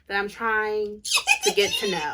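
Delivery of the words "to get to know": words pitched way up in a giggle; squealing giggle about a crush; giggling. Real, not acted. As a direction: laugh through the end of the line. Gushing over a hot actor.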